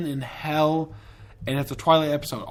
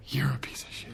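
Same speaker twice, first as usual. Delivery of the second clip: breathy, hushed comeback; whispered retort; 10 dB quieter than usual. Retold or imitated. Snapping back at friends who hated the movie, whispered. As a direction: whisper the insult back at them.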